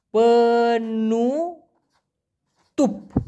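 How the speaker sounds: পেনুতুপ stretched very long, ending on a clear p; very slow pronunciation. Shown as a demonstration. Teacher stretching the word for lid for learners. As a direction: draw out পেনুতুপ slowly and close on p, not m. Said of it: "এখানে তো ম না, প".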